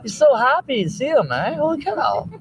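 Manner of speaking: high-pitched amazed gushing. Real, not acted. Marveling at the happy dog, high gushing voice. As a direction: gush high, like talk to a pet.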